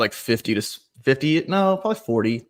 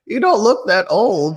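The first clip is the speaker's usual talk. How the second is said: put-on high, slow old lady voice; 8 semitones higher, 3 dB louder, slower than usual. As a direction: imitate a doubting old lady, pitched up and drawn out. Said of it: "I had an old lady".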